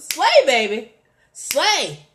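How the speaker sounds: loud, high, sassy shout-out; fierce and full of sass; with attitude. Shown as a demonstration. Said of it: "say it with an attitude".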